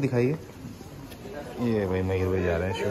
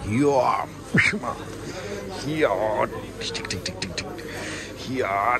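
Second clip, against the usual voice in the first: mock karate cries; 5 dB quieter than usual. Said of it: "इनको एक पंच मारते हैं अभी".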